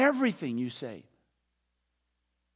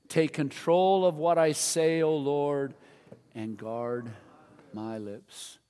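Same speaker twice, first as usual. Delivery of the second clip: praying out loud; slower than usual. Real, not acted. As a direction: lead a congregation phrase by phrase, with pauses. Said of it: "Let's pray this out loud together".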